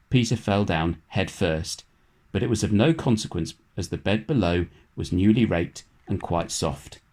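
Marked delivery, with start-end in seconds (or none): none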